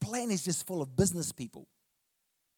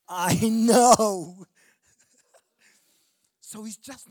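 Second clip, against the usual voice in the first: full-force preaching; 3 semitones higher, 7 dB louder than usual. Acted. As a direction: proclaim it to the whole room.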